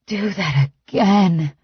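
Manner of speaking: slow, breathy plea; breathed. A narrator's reading. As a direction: breathe it out, begging a little. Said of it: "I breathe".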